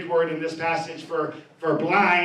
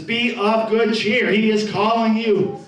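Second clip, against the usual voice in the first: slow calling out; 2 semitones higher, 3 dB louder, slower than usual. Acted. Crowd calls the blind man.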